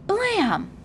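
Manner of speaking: high, sharp shouted bang; a boy fakes a shot; mock gun blast. A narrator's reading. Friend plays war, shouting a gun sound. Child mimics gunshot in play.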